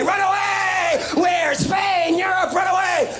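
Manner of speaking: high-pitched comic fleeing cry; panicked mock yell. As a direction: yell it like a kid bolting from trouble. Kids fleeing trouble, acted.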